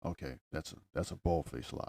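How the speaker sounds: very soft and low; low, hushed aside in an argument; muted disbelief. Real, not acted. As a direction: drop right down, grumbling the accusation. Giving up in mock argument.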